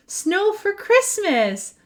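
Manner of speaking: high, slow joyful call; high stretched joyful shout; delighted shout. Acted. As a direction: cry out in wonder. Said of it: "shouts Owlette".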